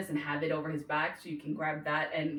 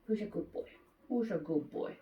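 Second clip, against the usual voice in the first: puppy praise; 7 dB quieter than usual. Real, not acted.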